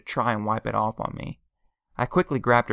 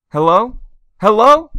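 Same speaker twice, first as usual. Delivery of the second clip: panicked cries, much higher and louder each time; screaming into the phone; 11 semitones higher, 14 dB louder, slower than usual. A narrator's reading. Aunt screaming into a dead phone for her husband. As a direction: call after a line gone dead. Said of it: "Aunt Laura screamed into the phone".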